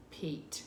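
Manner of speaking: Pete whispered with a long ee. Shown as a demonstration. Teacher whispering the corrected word to the class. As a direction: breathe Pete softly with a long ee. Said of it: "corrected long vowel".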